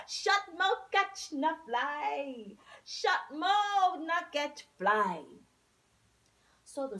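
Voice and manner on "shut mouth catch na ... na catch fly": Shallow booms his saying, higher and louder; loud folk saying. A narrator's reading. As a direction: proclaim it with a chuckle.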